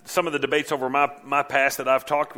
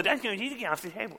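high, soft, rushed childish mimicry; mocking echo; 7 semitones higher, 6 dB quieter, faster than usual. Kid parroting a parent's order back, mocking. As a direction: garble it back in a squeaky jeer. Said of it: "what you said in a mocking tone".

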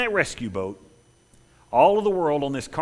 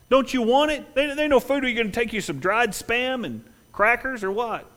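high, loud taunting voice; mocking partygoer; 12 semitones higher, 5 dB louder than usual. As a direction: scoff at their poor lifeboat. Crowd mocking the leaver.

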